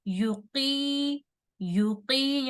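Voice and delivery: "युकी" with a short yu and long qee; quick first syllable. Shown as a demonstration. Teacher showing one count then two, short-long. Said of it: "जल्दी से पढ़ेंगे".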